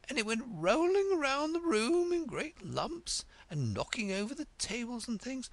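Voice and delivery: put-on a dithery old queen sharing a secret with Alice, lightly breathless.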